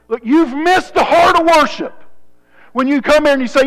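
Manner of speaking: high, loud pulpit rebuke; forceful preaching. Real, not acted. Preacher rebukes churchgoers for missing worship, forceful preaching. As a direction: call them out with force.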